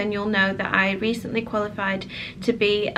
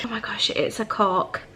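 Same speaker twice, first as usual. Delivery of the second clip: ordinary talk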